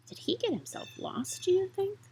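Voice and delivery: hushed whisper to the listeners; suspenseful storyteller whisper. A narrator's reading. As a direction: lean in and whisper the question.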